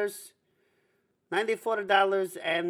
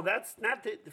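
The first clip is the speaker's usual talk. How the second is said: usual speaking voice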